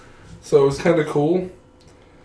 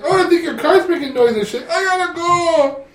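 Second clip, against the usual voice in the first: high whining falsetto, slowing down; hammed-up sister whimper; mock whiny Jill; 10 semitones higher than usual. Retelling Jill excusing herself from dinner, high whiny voice.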